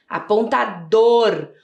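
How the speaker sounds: "Apontador" slow, ending on a held dor; completing by syllables. Shown as a demonstration. Teacher adding the missing syllable, "Apontador" sounded out slowly. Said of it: "tá faltando a sílaba dor".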